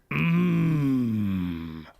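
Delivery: long, drawn-out hum, falling; overlong mmm. Retold or imitated. Reading out a chat partner's many M's, long hum. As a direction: hold the M far too long. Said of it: "Way too many M's".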